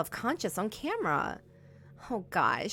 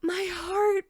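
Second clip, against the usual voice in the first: shaken; 11 semitones higher, 7 dB louder than usual. Real, not acted. Right after the jumpscare.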